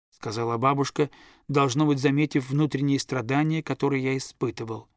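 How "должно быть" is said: usual speaking voice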